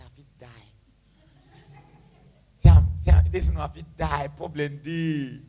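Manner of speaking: low, heavy imitation of a gloomy friend; hammed-up despondent groan; mock misery. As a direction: drag it out low, as a put-on moaner. Mimics friend always complaining.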